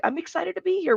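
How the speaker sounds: high, soft gushing fan voice; mock excited fan. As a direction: gush like an eager fan. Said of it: "everybody's like".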